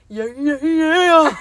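high, loud, drawn-out meme yell; hammed-up meme wail, stretched right out; James Charles meme. Friend quoting a meme to her co-host, exaggerated drawn-out wail. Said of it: "that James Charles meme".

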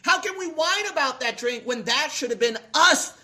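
high, driving pulpit exhortation; impassioned preaching. Real, not acted. Preacher asking how we can whine about Calvary, impassioned.

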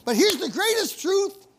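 loud, high, ringing declaration to the congregation; preaching climax. Real, not acted. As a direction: build to a triumphant shouted close.

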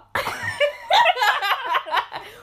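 loud high burst of laughter. Real, not acted. Laughing at the burning questions line.

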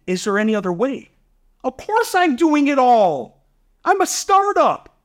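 high-pitched, fired-up comeback to the investor; exasperated retort. Retold or imitated. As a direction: voice jumps up, half laughing, as you replay your answer. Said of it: "I said back to him, I go".

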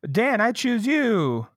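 slow, high-pitched trainer call; mock trainer call. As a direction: draw out the summons in a hammed-up way. Repeats trainer's call in bit.

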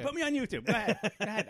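high-pitched, defiant dare; retold yelling. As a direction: reenact a fed-up parent yelling at an onlooker. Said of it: "and I yelled at one point".

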